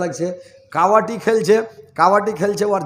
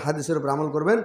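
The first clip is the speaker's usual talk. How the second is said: ordinary talk, nothing marked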